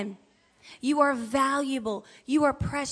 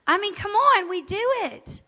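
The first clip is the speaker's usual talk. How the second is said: high and loud, come on; excited; 8 semitones higher, 7 dB louder than usual. Real, not acted. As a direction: rise up, teasing the room. Excited admission to congregation.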